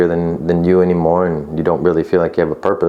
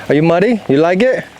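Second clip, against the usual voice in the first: high playful dog talk; 12 semitones higher than usual. Real, not acted. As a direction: tease the dog with a playful countdown. Talking to dog while playing.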